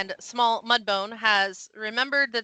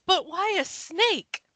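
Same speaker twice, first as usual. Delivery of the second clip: bewildered horse; 8 semitones higher, slower than usual. Acted. Voices the horse's bewildered reply.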